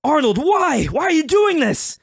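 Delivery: high, loud, pleading cry; acted desperate yell; anguished dad's shout. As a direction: shout it like a betrayed friend. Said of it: "So your dad's like".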